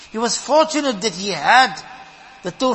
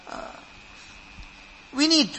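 ordinary delivery

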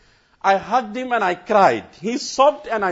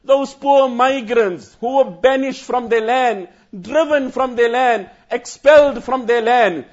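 rising, louder sermon voice, pressing each phrase; impassioned preaching; 6 semitones higher, 6 dB louder than usual. Acted. Telling the scholars' grief to the congregation, fervent preaching. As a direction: preach it with building fervour.

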